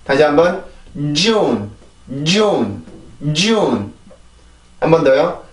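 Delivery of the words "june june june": JUNE as one stressed, falling English syllable; English pronunciation. Shown as a demonstration. Teacher repeats it for learners, English pronunciation. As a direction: say JUNE with force from the J. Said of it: "이렇게 발음이 되는데".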